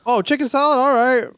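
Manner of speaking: high, bright mock voice well above normal; mock clueless diner. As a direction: parody of someone pleased with plain food.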